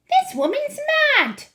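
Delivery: very high, loud indignation; shrieky, affronted wife; outraged mother. A narrator's reading. Mrs. Jenkins complains of the grandmother, high and outraged.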